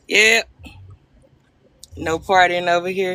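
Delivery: usual speaking voice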